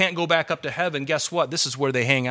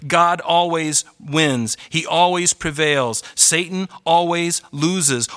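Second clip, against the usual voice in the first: slow emphatic declaration; 2 semitones higher, slower than usual. Real, not acted.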